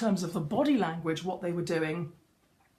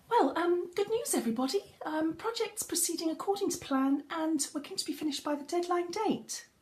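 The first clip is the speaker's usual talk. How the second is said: high, nervous-sounding voice that loses authority; high-pitched, unsure delivery; 9 semitones higher than usual. Shown as a demonstration. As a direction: say it high and hesitant, like you lack confidence. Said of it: "higher pitches of voice tend to have less authority".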